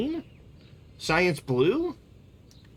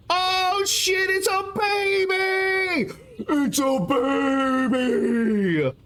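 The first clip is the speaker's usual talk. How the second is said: excited shout; much slower than usual. Real, not acted. Excited shout at a baby photo.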